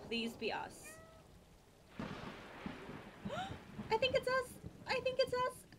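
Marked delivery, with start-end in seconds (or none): 1.9-3.9 high breathless gasp of delight; sharp excited gasp. Real, not acted. Sees kittens, thinks it's them. 3.9-5.7 high, loud thrilled cry; bursting with excitement; excited squeal. Real, not acted. Excited realizing she's a kitten.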